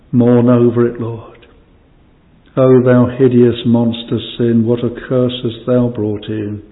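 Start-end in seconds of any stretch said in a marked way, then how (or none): none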